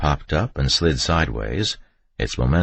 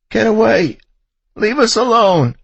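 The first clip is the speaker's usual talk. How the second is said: very high, loud frantic shriek; terrified mother's scream; 9 dB louder than usual. A narrator's reading. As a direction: scream to drive off a threat. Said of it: "shrieking it at the top of her lungs".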